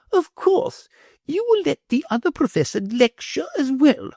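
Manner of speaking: high, commanding noblewoman; grand lady voice. A narrator's reading.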